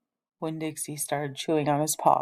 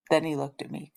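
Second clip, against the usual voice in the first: ordinary delivery, nothing marked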